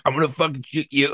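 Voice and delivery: lightly voiced cop barking a threat; mock partner threat. Partner aiming the cannon at the suspect, mock threatening yell.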